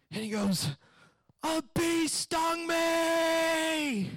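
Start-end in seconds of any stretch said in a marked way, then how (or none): 1.4-4.1 high, long-drawn wail; imitated kid screaming. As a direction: stretch it into a hammed-up child's scream. Screaming kid reveals the cause.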